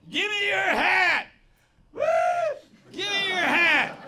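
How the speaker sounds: mimicking a rowdy guy shouting up at the stage; imitated heckler's shout. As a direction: whoop and yell like a rowdy fan. Imitates drunk dude shouting from the front.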